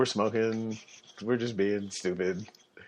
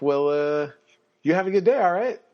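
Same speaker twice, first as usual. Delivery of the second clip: much higher, louder cheery send-off; cheery cop; 8 semitones higher, 5 dB louder than usual. Retold or imitated. Officer lets the stop-sign runner go, high and cheery. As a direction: wave him off kindly.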